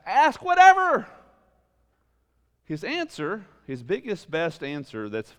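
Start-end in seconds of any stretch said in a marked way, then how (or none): 0.1-1.1 loud, high, slow cry; a preacher's ringing call; shouted urging. Real, not acted. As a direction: belt it out to the whole room. Preacher urging listeners to ask.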